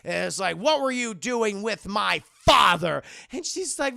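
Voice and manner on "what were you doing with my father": slow, loud accusing demand; mock confrontation. Indy confronting Elsa about his father, mock angry demand. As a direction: build to a loud hit on "father".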